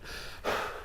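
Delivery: a long winded exhale; unvoiced panting breath; heavy breathing. Shown as a demonstration. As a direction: breathe hard like you ran the stairs. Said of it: "This is the whole Mark Wahlberg impression".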